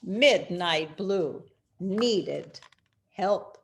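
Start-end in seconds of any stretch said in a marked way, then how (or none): none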